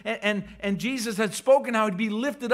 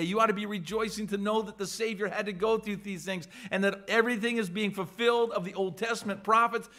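ordinary delivery

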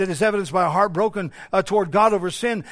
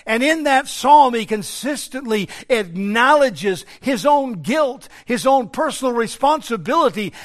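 raised, louder preaching voice; forceful preaching; 4 semitones higher, 5 dB louder than usual. Real, not acted. Preacher warns congregation about blindness to their own sin, forceful preaching. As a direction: drive it home from the pulpit.

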